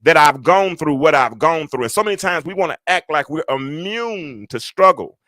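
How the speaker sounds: high, loud preacher's fervor; impassioned high-pitched sermon delivery; preaching. Real, not acted. Preaches to online congregation.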